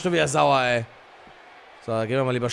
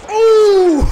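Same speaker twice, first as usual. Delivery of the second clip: effort yell; 11 dB louder than usual. Real, not acted. Wrestling move, big yell.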